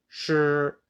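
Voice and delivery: "sure" held high and level; first tone. Shown as a demonstration. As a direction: say "sure" on a high, even note. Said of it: "I can do the first tone".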